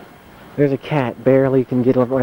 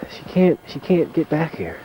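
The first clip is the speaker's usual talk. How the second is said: ordinary talk, nothing marked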